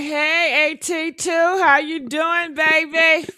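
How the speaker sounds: high, drawn-out hello; high sing-song greeting. Real, not acted. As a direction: greet the viewer sweetly, up high. Greeting viewer in stream voice.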